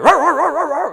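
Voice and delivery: a dog bluffing a fight, mock roaring. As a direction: growl like a posturing dog.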